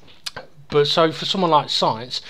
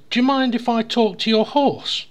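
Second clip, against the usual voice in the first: higher-pitched polite request; joke's Englishman; 8 semitones higher, 3 dB louder than usual. Acted. Englishman asks Welshman about his horse, higher polite request. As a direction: ask permission in a lifted voice.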